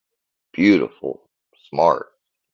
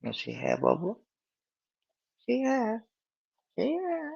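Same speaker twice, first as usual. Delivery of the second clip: higher and higher, sing-song coaxing the dog; 8 semitones higher than usual. Real, not acted. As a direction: coo at the dog to say hi, climbing up in pitch.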